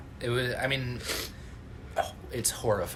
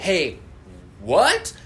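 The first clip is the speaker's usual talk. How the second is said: surprised; 7 semitones higher, 5 dB louder than usual. Retold or imitated. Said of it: "you're like".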